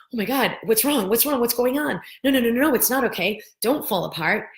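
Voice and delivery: frantic no no no at a crying partner; alarmed panic. Retold or imitated. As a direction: panic at someone falling apart. Person panicking at someone crying.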